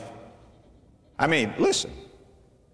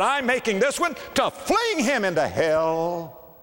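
mock divine decree; 6 semitones higher, slower than usual. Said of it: "fashioned a creature and said".

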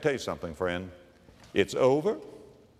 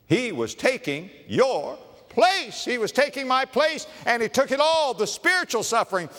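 preaching climax; 7 semitones higher than usual. Real, not acted. Climax of the substitution.

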